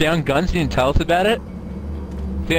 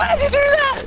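way higher, astonished; amazed squeal. Real, not acted. Watching teammate escape, astonished high squeal. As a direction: shoot up in disbelief.

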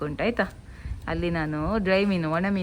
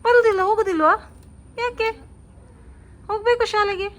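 coaxing mother; 12 semitones higher, 5 dB louder than usual. Real, not acted. Mother coaxing daughter about school.

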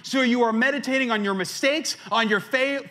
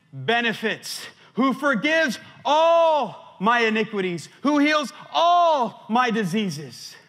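proclaiming scripture; 3 semitones higher than usual. Acted. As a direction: declaim the psalm like a preacher at full force. Reads psalm aloud with force.

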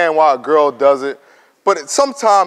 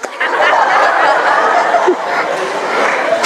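breathy high-pitched laughter; 13 semitones higher than usual. Real, not acted. Laughing at own joke.

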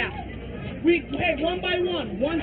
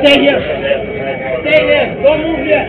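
loud commanding shout, holding everyone back; urgent shout; 3 semitones higher, 9 dB louder than usual. Real, not acted. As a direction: bark the order to stay put. Officer holding people back in crisis.

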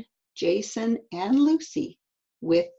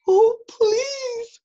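high, loud frightened plea; high-pitched, urgent child's pleading; 6 dB louder than usual. Acted. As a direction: beg like a scared little boy. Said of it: "whispered Eddie".

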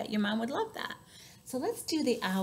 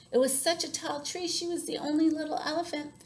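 higher, plaintive narration; sympathetic storytelling; 6 semitones higher than usual. A narrator's reading. Storyteller on elephant without ideas, high and plaintive. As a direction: make the little elephant sound small and helpless.

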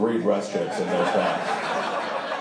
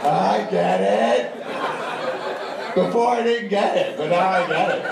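loud, very high burst of mock revelation; hammed-up realisation; 8 semitones higher, 4 dB louder, slower than usual. Comic finally catches the trooper's hint, exaggerated loud realisation. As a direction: overplay the penny dropping, loud and high.

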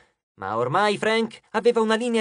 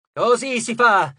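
slow, loud, higher cheer; exclaiming; 4 semitones higher, 5 dB louder, slower than usual. A narrator's reading. Nico grinning at the turning battle, exclaimed cheer. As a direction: cheer the troops on heartily. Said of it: "esclamò".

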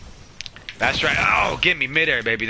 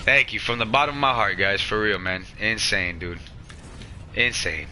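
low, soft, tearful thanks; choked up; 6 semitones lower, 4 dB quieter than usual. Real, not acted. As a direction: hold back tears. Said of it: "Wipe the eyes, big fella".

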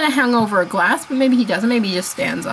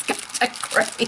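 usual speaking voice

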